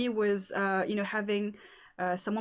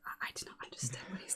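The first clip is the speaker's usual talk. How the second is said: hushed, fast whisper; whispering to roommate; 6 semitones lower, 13 dB quieter, faster than usual. Retold or imitated. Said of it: "I was like".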